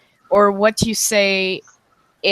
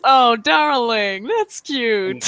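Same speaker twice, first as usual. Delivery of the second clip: slow, drawn-out condescending darling; patronizing put-down, words stretched out; 2 semitones higher, much slower than usual. Retold or imitated. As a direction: imitate a lofty woman sweetly dismissing a suitor. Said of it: "it's just how she answers".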